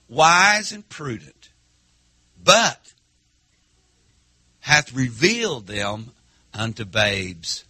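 slow, measured verse read to the church; scripture reading. Acted. Preacher reading the verse aloud to the congregation, slow and measured. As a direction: let each word of the verse land slowly.

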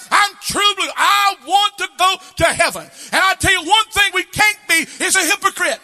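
high, loud, shouted revival preaching; full-force preaching. Acted. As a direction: preach it hard to lift the congregation.

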